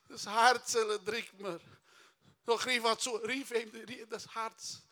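mock heart-attack plea; acted heart distress. Stricken man complaining of his heart, acted distress.

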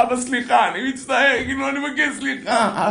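put-on high, pleading little-boy voice; tearful child apologizing. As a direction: beg dad's forgiveness, acted. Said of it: "והילד בוכה". (ילד מתחנן בבכי, בחיקוי)

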